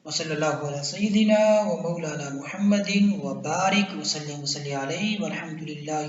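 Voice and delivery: soft, low chanted Arabic blessing; prayer recitation. Real, not acted.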